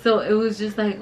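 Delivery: normal talking voice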